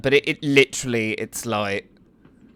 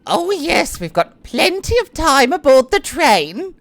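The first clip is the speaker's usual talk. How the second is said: Annette's voice; 11 semitones higher, 3 dB louder than usual. Acted.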